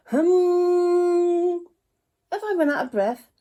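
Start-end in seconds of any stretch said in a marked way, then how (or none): 0.1-1.7 held hum, high and loud. Shown as a demonstration. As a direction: buzz it like a bee. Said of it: "he began to hum".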